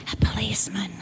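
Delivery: tiny whispered no; hushed child's whisper. Retold or imitated. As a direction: whisper it like a small child hiding. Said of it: "And still whispering".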